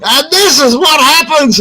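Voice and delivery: high, proclaiming how-to voice; son's showman instruction. Retold or imitated. Son shows crowd how to ride chair, showman instruction.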